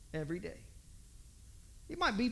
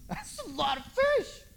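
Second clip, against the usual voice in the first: much higher, slow put-on voice; higher, slow mocking aside; 11 semitones higher, slower than usual. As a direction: squeak it out mockingly. Mocking past catch.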